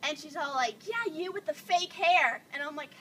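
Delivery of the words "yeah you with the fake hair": higher, louder, drawn-out jeer; taunting heckler. Retold or imitated. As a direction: imitate a mean girl's slow taunt.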